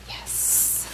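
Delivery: a tiny breathy triumphant yes under the breath; whispered yes. Acted. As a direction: cheer quietly, as if only for yourself. Reader cheers Red on quietly.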